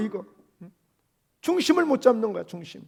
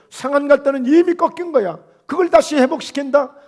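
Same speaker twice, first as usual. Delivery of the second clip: impassioned preaching; 3 semitones higher than usual. Acted. Impassioned preaching on bruised reed.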